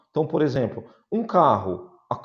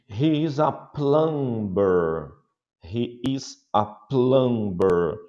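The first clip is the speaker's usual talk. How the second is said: slow model answer; much slower than usual. Shown as a demonstration. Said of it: "slow model answer".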